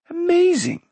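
high-pitched exclamation of wonder; amazed call. A narrator's reading. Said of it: "called Rune".

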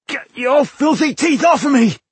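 very high, loud pained yell; furious shout. Acted. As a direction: scream at the beast in pain. Dwarf seized in mare's jaws.

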